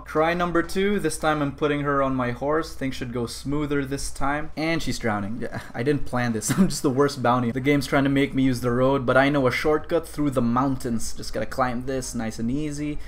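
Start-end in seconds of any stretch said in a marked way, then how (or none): none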